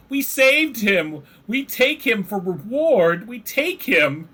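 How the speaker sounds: high, slow, squeaky little creature voice; high, slow kobold proudly reporting to the dwarves. Acted. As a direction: pipe it up like an eager kobold.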